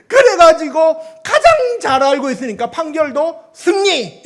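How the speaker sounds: preacher announcing the court victory; triumphant exclaim. Real, not acted. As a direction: ring out the win. Climax of the testimony.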